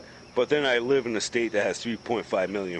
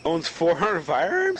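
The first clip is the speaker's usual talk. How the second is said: higher, slow disbelieving question; incredulous question; 6 semitones higher, 3 dB louder than usual. Real, not acted. Asking his friend, not believing the number, incredulous. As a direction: stretch it out in disbelief.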